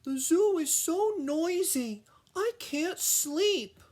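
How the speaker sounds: very high, tired complaining voice; Lucy the giraffe, high and whiny. A narrator's reading. Tired giraffe whining to Ellie about the noise. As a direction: squeak it out like a sleepless giraffe. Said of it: "Lucy cried".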